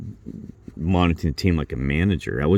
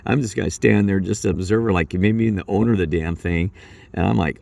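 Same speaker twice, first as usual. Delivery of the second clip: usual speaking voice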